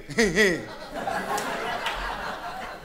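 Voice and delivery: airy laugh; laughing at own bit; breathy laugh. Real, not acted. Laugh after bashful bit.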